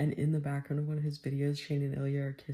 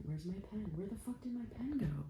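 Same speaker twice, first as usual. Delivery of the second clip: frantic; 6 semitones higher, 7 dB quieter than usual. Real, not acted. Searching frantically for pen.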